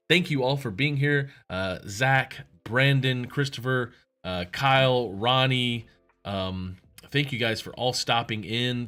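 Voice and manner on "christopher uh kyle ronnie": ordinary delivery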